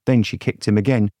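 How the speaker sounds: usual speaking voice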